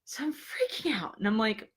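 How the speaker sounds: hushed, whispered aside; freaked-out whisper. Retold or imitated. Storyteller confiding she was freaking out, whispered. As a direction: whisper it, as if spooked.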